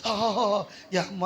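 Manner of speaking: mock feverish moan. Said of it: "నిజంగా ముసుగెట్టుకుని".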